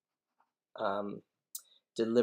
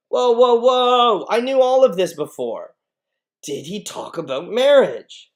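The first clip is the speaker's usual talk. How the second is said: very loud, high interruption; impatient outburst; 11 semitones higher, 15 dB louder than usual. Acted. Juliet interrupting nurse to ask about marriage, loud impatience. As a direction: cut in forcefully, demanding the real news.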